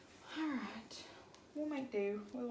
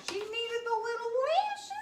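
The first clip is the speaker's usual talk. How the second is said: gushing; 11 semitones higher, 5 dB louder than usual. Real, not acted. Gushing over lashes.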